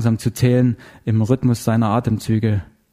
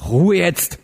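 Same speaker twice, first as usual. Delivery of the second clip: higher, curt angry order; thundered command; 6 semitones higher than usual. A narrator's reading. Heller grabbing the neighbour, angry command. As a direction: order silence, pitch jumping up. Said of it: "donnerte er".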